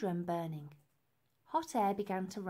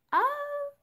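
startled oh; 7 dB louder than usual. Acted. Elsie realises.

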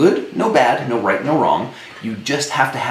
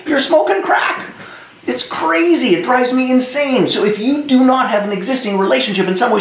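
exasperated rant; 12 semitones higher than usual. Real, not acted. Coach rants at would-be leaders who don't know their tribe, much higher and exasperated. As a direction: vent it like the question drives you crazy. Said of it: "that one gets me, as you might be able to tell".

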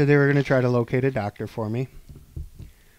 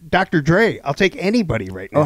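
louder, higher mock demand; half-joking raised demand for a doctor; 4 semitones higher, 6 dB louder than usual. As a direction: call out for anyone at all. Demanding any doctor, calling out.

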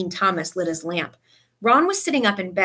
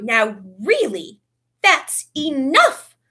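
slow, loud, stern reprimand; 5 semitones higher, 5 dB louder, much slower than usual. A narrator's reading. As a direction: cut them off sternly. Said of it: "stared furiously around".

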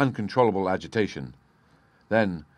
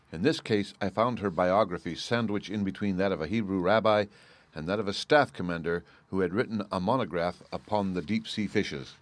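usual speaking voice

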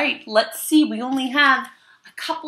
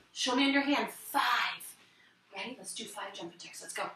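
ordinary delivery

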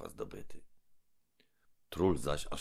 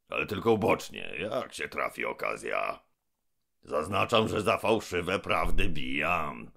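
menacing giant mouth; 3 dB louder than usual. Acted. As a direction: boom down from above. Gębon explains his wishes.

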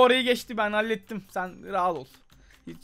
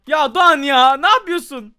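loud, higher cry of frustration; exasperated yell; 3 semitones higher, 11 dB louder than usual. Real, not acted. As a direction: scold your friend loudly. Yelling at teammate Doğan again.

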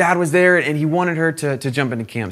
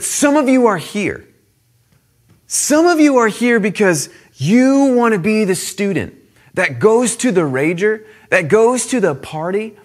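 raised, higher preaching voice; impassioned preaching; 6 semitones higher, 4 dB louder than usual. Retold or imitated. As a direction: call the crowd out with conviction. Preacher retelling his own call.

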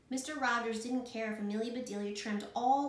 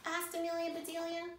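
ordinary delivery

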